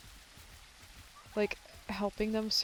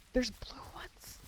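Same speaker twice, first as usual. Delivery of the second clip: amazed whisper; 7 semitones higher, slower than usual. Real, not acted. Amazed at blue bananas.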